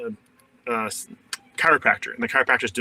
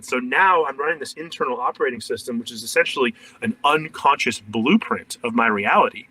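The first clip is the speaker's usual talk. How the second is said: ordinary delivery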